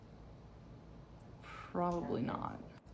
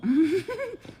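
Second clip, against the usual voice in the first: a short high burst of laughter, louder than her talk; 11 semitones higher, 8 dB louder than usual. Real, not acted. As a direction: laugh out at her own joke. Laughing at her own joke.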